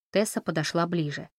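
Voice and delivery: usual speaking voice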